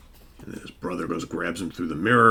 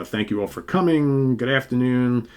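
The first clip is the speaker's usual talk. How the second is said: lightly acted public speaker, raised voice; higher, louder welcome to the room; professor addressing press; 5 semitones higher, 5 dB louder than usual. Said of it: "he starts going and talking about".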